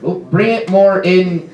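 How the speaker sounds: high, loud frontman hyping the crowd; rally the mosh pit, then fuss over its shape; OCD punk singer. Acted. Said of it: "my impression of a, the lead singer of a punk band who is O C D".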